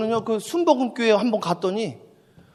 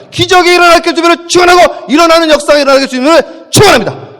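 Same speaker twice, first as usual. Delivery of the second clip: louder, higher, full-force benediction; fervent shouted blessing; 7 semitones higher, 13 dB louder than usual. Real, not acted. Pastor ending the sermon, blessing the congregation at full shout. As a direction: bless the flock with everything you have.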